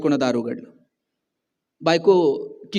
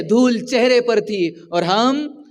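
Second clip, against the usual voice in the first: slow, measured Urdu couplet, fading away; शेर धीमे अंदाज़ में पढ़ना; reciting a sher; 3 semitones lower, slower than usual. Shown as a demonstration. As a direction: savour the couplet slowly. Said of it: "एक शेर आहे".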